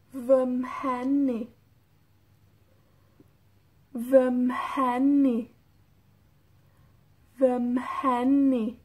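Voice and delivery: "Vemheni" said slowly with a breathy mh; slow model for repeating. Shown as a demonstration. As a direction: stretch out each syllable for learners to copy. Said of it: "Escuchen cómo se, cómo suena". (lento y claro para que repitan: Vemheni)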